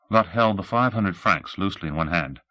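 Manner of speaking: ordinary delivery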